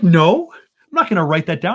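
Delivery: telling the agent he won't write it down, loud and firm; firm refusal. Retold or imitated. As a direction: refuse flat out, a bit indignant. Retold refusal to border agent.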